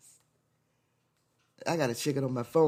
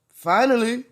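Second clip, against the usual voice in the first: sarcastic; 5 semitones higher, 9 dB louder than usual. Real, not acted. Sarcastic mock celebration.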